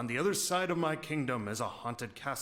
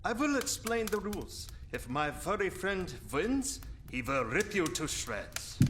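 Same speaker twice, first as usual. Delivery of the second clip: high, put-on villain voice with cackle; menacing vampire; 4 semitones higher than usual. Vampire explaining deadly card game rules to Hans, gloating. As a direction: gloat over the stakes.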